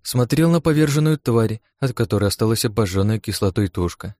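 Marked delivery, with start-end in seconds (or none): none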